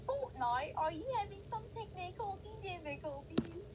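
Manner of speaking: imitating a British meme voice; mock-British questioning; British accent. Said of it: "it was, like, in a British accent".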